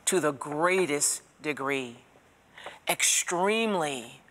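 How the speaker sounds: normal talking voice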